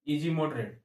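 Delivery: ordinary talk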